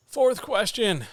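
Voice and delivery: high, loud, slow reveal; dramatic announcement. Acted. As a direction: announce it like big news.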